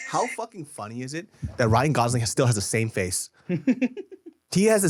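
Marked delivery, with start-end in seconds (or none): none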